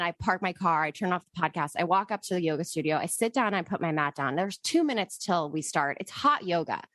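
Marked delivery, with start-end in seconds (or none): none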